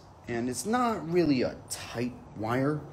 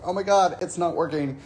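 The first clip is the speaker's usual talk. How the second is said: higher, louder panicked cry; mock panic; 7 semitones higher, 6 dB louder than usual. Stuck on the zip line, mildly panicking. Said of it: "It was like".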